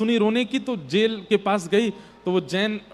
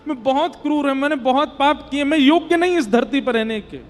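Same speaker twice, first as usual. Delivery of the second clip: raised, emphatic self-reproach; remorseful plea; 6 semitones higher, 4 dB louder than usual. Retold or imitated. Repentant bandit at merchants' feet, pleading with raised voice. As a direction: confess urgently in a higher voice.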